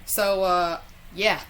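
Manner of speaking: normal talking voice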